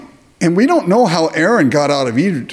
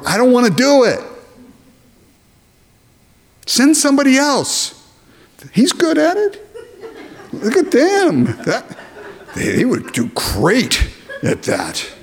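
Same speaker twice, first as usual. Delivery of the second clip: mock reluctant prayer; 9 semitones higher than usual. Preacher voices reluctant prayer to Lord.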